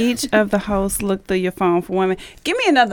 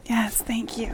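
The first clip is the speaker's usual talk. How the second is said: a soft breathy whisper of relief; 10 dB quieter, slower than usual. Real, not acted. Contestant whispering thanks after answer is confirmed, hushed whisper. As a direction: whisper your satisfaction. Gloating quietly over right answer.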